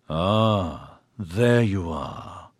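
gloating. A narrator's reading. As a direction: savour every word like a predator. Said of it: "a long talk voice gloated".

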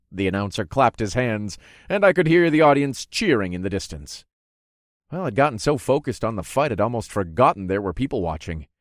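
usual speaking voice

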